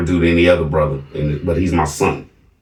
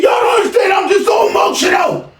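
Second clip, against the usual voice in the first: much higher screamed mimicry; imitating son screaming. Son screaming outside his father's house, high scream. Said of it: "he's screaming loud at this point".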